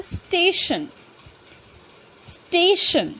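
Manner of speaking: "स्टेशन" ending clearly in shan. Shown as a demonstration. Teacher showing the tion rule. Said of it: "हम बोलते हैं उसको शन की आवाज़".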